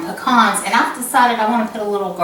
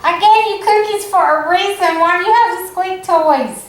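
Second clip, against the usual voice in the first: high-pitched complaint to the dog; pleading, high-voiced telling-off; exasperated pet scolding; 9 semitones higher, 4 dB louder than usual. Real, not acted. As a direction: scold a squeaky-toy culprit, pitched way up. Mock-scolding the dog over toys.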